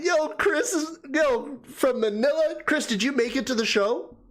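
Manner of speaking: high, delighted call-out; excited yo. Real, not acted. Streamer spotting viewer Chris in chat, thrilled. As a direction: hail a friend you spot in the crowd.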